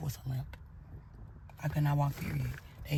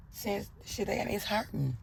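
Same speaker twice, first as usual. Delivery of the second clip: crying; 5 semitones higher, 5 dB quieter, slower than usual. Retold or imitated. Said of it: "I'm crying hysterically".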